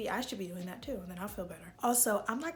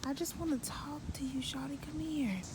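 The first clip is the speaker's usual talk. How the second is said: whisper; 5 semitones higher than usual. Real, not acted. Coaxing the cat closer.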